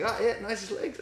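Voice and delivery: put-on high-pitched Kiwi accent; light, high mock New Zealand voice; Legs Eleven. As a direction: imitate a smug New Zealand character. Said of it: "That's not a bad Kiwi".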